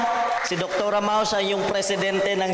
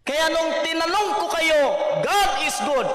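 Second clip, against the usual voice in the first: shouted call; 7 semitones higher than usual. Real, not acted. Preacher calling out to crowd.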